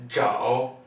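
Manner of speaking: zhao tief, fallend und wieder steigend; third tone. Shown as a demonstration. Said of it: "Third tone".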